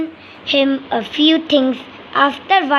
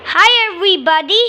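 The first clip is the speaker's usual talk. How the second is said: fast, high, louder toy-bird greeting; squeaky little kiwi; 5 semitones higher, 4 dB louder, much faster than usual. Pretend. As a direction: chirp it out like a tiny bird. Kiwi toy introduces itself.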